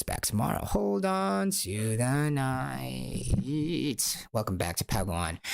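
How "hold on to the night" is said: very slow, stretched, higher farewell line; drawn-out sign-off. Acted. Host closing the episode with a slow, stretched farewell. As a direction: stretch every word like a late-night radio sign-off.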